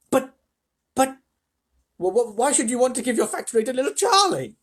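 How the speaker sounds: high, loud, flustered question; stammer, then protest in high disbelief; incredulous stammer. A narrator's reading. Grandpa Joe stammers in disbelief.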